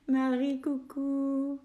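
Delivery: cheerful high coucou; bright greeting. Real, not acted. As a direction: greet her with delight.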